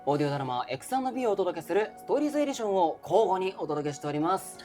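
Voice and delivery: normal talking voice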